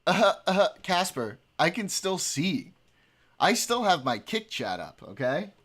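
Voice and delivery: loud firm warning. Real, not acted. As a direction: stop the cat, firmly. Scolding the cat.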